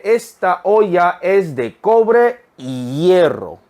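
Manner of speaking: very slow, word by word; slow reading. Shown as a demonstration. Teacher reading an example slowly to students. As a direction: read it slowly for learners. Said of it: "한 번 더".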